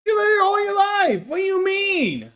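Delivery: high, loud indignant shout; indignant yell. Acted. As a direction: yell it up high, outraged he doesn't know. Game character scolds in read dialogue.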